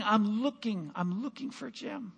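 normal talking voice

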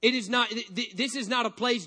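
ordinary delivery

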